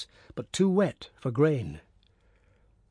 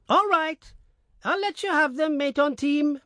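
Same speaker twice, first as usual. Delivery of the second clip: peasant woman calling out; 13 semitones higher than usual. Acted.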